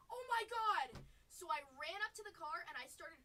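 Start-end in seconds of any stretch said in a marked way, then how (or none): 0.1-1.0 loud, high shout of panic; a sudden realisation of loss; panicked yell. Retold or imitated. Realizing the case is left in the car, panicked shout. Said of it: "I was like".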